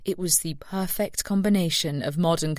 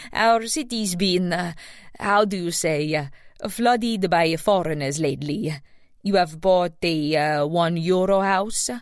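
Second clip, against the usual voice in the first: put-on heavy Italian accent. A narrator's reading. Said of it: "she said in a heavy accent".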